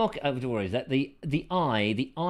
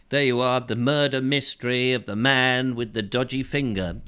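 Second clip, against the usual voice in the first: normal talking voice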